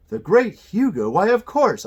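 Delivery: loud, high exclamation; startled recognition. A narrator's reading. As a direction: burst out as the name suddenly clicks. Aunt reacts sharply to the name.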